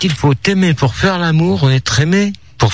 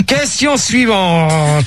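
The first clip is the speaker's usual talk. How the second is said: slow, stretched-out call; drawn-out announcement; much slower than usual. Real, not acted. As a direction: move on with long, dragged syllables. Host announces the next question.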